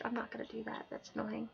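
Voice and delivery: plain reading voice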